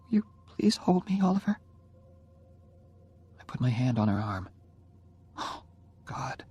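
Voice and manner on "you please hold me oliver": put-on dying girl asks Oliver to hold her, higher voice; Jenny's voice, pleading. A narrator's reading. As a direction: ask to be held, tenderly.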